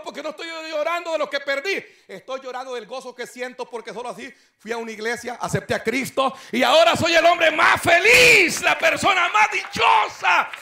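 loud, high shouting, slowing down; joyful crying shout. Retold or imitated. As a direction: shout out his joy through tears. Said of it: "Y cuando él está llorando".

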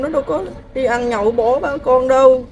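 usual speaking voice

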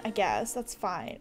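normal talking voice

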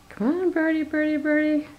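high sing-song bird call; coaxing a wild bird. Real, not acted.

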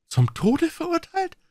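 very high, slow stunned question; high, faltering aghast question; barely able to speak. A narrator's reading. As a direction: choke out the words in horror. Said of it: "konnte die Worte kaum aussprechen".

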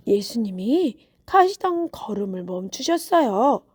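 usual speaking voice

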